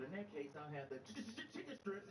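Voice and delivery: ch- ch- ch- chicken strips, stuttering on the first sound; mock stutter on chicken, aimed at the waiter. Daughter mocks the stuttering waiter, teasing. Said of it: "looked directly at the waiter".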